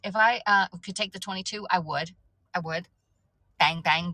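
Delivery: ordinary talk, nothing marked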